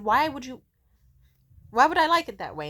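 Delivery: retold baffled protest; exasperated retort. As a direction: push back loudly at a retold absurd suggestion. Retold exasperated argument over food.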